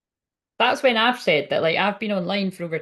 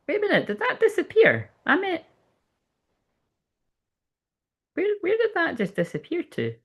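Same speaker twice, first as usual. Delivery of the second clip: puzzled; 9 semitones higher than usual. Real, not acted. Comment vanished from screen.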